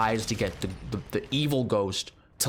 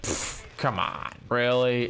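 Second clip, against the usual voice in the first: scoffing; slower than usual. Retold or imitated. Skeptic grandpa scoffing.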